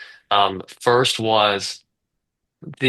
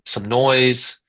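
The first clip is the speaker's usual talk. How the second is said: normal talking voice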